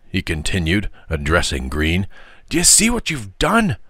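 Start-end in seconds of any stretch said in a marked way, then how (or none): 2.5-3.8 high-pitched indignant question; outraged, voice pitched up; boiling indignation. A narrator's reading. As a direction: confront him with what he did. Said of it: "with the energy of boiling indignation".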